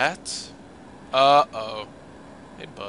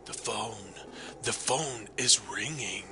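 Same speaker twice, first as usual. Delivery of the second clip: lower, softer radio announcer voice; a light take on a radio announcer, lower and softer; 5 semitones lower, 10 dB quieter, slower than usual. Shown as a demonstration. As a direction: croon it like a late-night DJ. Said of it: "That'd be my radio voice".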